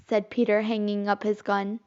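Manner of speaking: ordinary delivery, nothing marked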